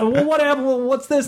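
high, eager questioning voices; players clamoring. Retold or imitated. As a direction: pepper the GM with questions. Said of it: "everyone's like".

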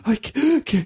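gasping, high, choked words; winded panic. Retold or imitated. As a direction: imitate having the wind knocked out. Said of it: "I was panicking".

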